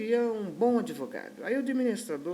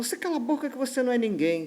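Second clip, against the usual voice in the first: fast, higher imitated scolding; quick, raised-pitch mock tirade; angry silencing; 6 semitones higher, 4 dB louder, faster than usual. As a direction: act out a brusque put-down. Client snaps at cleaner, acted quarrel.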